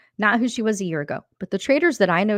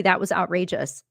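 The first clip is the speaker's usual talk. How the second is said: usual speaking voice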